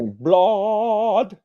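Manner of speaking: "blood" as a high, stretched "blaaahd"; rising, drawn-out "blood" rhyming with nod; exaggerated blod. Shown as a demonstration. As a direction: draw "blood" out long with an ah vowel, pitch climbing. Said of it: "you had to say".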